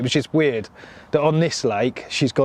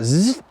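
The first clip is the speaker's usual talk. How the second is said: quick rising zip noise; imitated zipper sound; 5 semitones higher, 3 dB louder than usual. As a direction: buzz like a tent zip opening. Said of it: "the door's unzipped".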